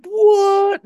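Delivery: high, loud shocked yelp. Retold or imitated. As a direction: blurt it out in disbelief. Retold neighbor's shocked reaction.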